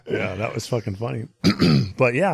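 ordinary talk, nothing marked

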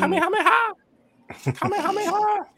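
very high, squealed mock-Japanese battle cry; Japanese anime attack cry. Mimicking the Japanese show for laughs, high-pitched mock anime cry. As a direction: shoot up into a comic falsetto chant. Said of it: "like the Japanese joint say".

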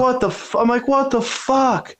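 high-pitched retold bewilderment; startled disbelief. Storyteller replaying his shock at the unseen yeller, startled.

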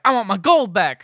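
loud, high leprechaun demand; mock angry demand.

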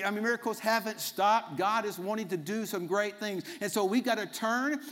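ordinary talk

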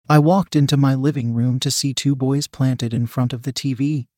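plain reading voice

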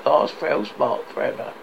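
usual speaking voice

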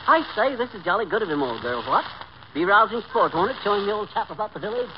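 hammed-up British chap; mock-posh British accent; put-on British accent. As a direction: play the jolly English gentleman, badly. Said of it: "Some of the English has come off on you".

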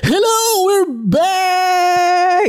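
excited. Real, not acted. Podcast host opening the show.

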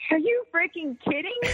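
high-pitched incredulous mock scream; re-enacted shriek of disbelief; retold scream. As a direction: squawk it in total disbelief. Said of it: "I'm screaming".

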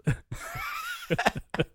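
high squeaky laugh; squealing laugh. Real, not acted. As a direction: let a laugh break up into a squeal.

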